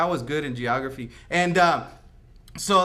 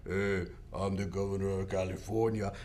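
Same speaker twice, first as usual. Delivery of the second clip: low pompous governor; 10 semitones lower, 8 dB quieter than usual. Retold or imitated. Ghost governor declares himself.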